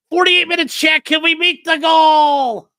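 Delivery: high, loud, slow rallying shout; hyping chat. Real, not acted. Rallying chat to hit the stream goal, a high loud shout. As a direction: pump up the crowd.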